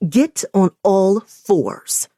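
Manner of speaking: slow, loud, commanding order; harsh dom voice. Acted. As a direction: lay down the command like a strict mistress. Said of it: "in my harshest dom voice".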